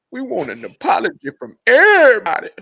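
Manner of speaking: hammed-up protest shout; mock demanding shout.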